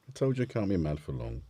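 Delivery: ordinary talk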